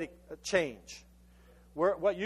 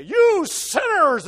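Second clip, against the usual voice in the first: much higher, much louder, very slow thundering rebuke; God thundering at sinners from the pulpit; booming accusing God; 10 semitones higher, 12 dB louder, much slower than usual. Acted. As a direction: hurl it down like a condemning preacher. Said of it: "and he would say".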